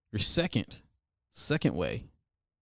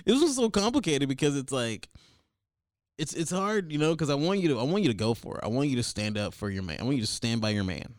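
normal talking voice

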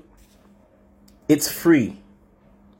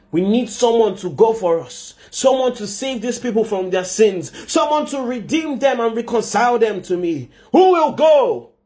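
mounting appeal; 7 semitones higher than usual. Acted. God asks heaven who will redeem people, mounting appeal. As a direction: climb phrase by phrase to a ringing challenge.

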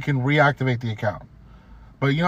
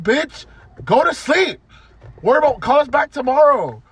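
exasperated retort; 8 semitones higher, 6 dB louder than usual. Retold or imitated. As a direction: blurt it out fed up and louder. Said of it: "I'm like".

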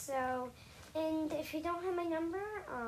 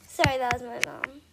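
loud; 11 dB louder than usual. Real, not acted. Explaining mom's interruption loudly.

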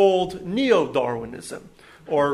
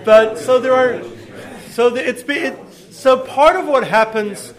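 louder, higher, talking over the room; raised voice; 5 semitones higher, 6 dB louder than usual. Real, not acted. Lecturer regaining a chattering audience's attention, raised voice. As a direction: push on over audience chatter, restarting the phrase.

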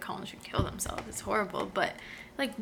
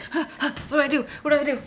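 imitating a panicked character, higher and much louder; gasping, half-laughing mock freak-out; 5 semitones higher, 7 dB louder, much faster than usual. Host playing a panicked Edward with no time, mock panic. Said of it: "Right now he's like".

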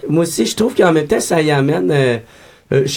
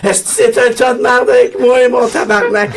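mock angry call; 8 semitones higher than usual. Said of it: "juste faire".

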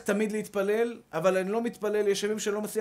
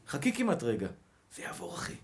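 calming whisper; 7 semitones lower, 7 dB quieter than usual. Real, not acted. Preacher soothes his brother in a low whisper. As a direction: drop low and whisper it gently.

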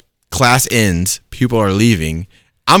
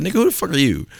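high, softer indignant retort; retold high-voiced comeback; incredulous challenge; 8 semitones higher, 4 dB quieter, faster than usual. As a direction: pitch it up in disbelief. Repeats his challenge to stranger.

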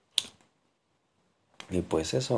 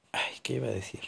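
whispering; 5 semitones higher, 5 dB quieter, faster than usual. Real, not acted. Forgetting mid-talk.

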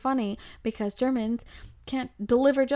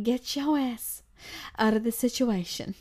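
sassy inner voice; 2 semitones higher than usual. Retold or imitated. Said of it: "smart Alicia snaps right back".

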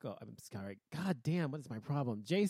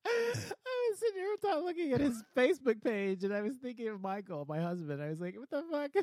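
laughing; 11 semitones higher, 4 dB louder than usual. Real, not acted. Laughing over wrong name.

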